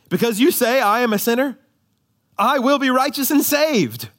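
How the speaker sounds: high, loud defiant retort to the devil; defiant Luther. Retold or imitated. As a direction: stand up to Satan with Luther's boldness. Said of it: "But Luther says this".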